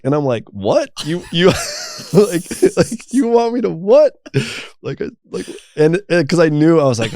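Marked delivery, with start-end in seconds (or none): 0.5-4.1 acted-out incredulous reply, pitch jumping up on "what"; stammer, then blurt it higher in mock disbelief; shocked disbelief. Recounting his reply to God's command, incredulous.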